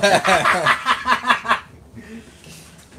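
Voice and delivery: big loud burst of laughter. Real, not acted. Laughs at viewer's comment.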